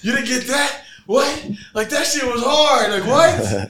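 loud, high-pitched incredulous protest; retold outraged disbelief. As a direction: act out being appalled at the crew. Acts outraged reaction to camera failure.

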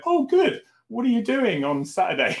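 high-pitched, gleeful retold reply; brightly opportunistic, swooping high; mock delight. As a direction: pounce on the chance, pitched well up. Retelling his gleeful reply to friend.

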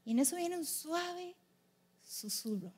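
slow and soft, sinking on the last word; delicate, suspenseful narration; gentle hush. A narrator's reading. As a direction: let it settle into near-whisper. Gentle whisper after the disasters.

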